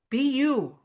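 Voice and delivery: usual speaking voice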